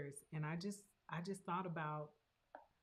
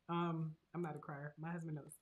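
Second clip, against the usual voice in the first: ordinary talk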